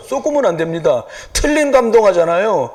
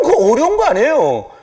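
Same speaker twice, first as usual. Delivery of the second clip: ordinary talk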